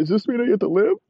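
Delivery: high loud hammed-up sobbing plea; high hammed-up tearful plea; mock crying plea. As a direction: beg through mock tears, high. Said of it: "Teddy's crying and screaming".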